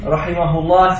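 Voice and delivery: ordinary talk, nothing marked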